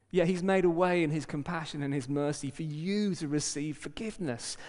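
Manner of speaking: ordinary delivery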